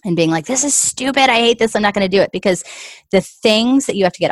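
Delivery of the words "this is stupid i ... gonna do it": retold outburst, pitched higher; mock frustration. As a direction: toss it off like a mild tantrum. Throwing the book across the room.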